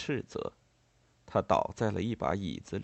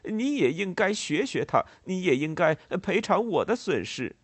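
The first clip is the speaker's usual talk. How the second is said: raised, higher voice of a heated accuser; acted-out angry insistence; indignant demand; 7 semitones higher, 4 dB louder than usual. A narrator's reading. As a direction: press the demand loudly and indignantly. Demanding payment in acted quarrel.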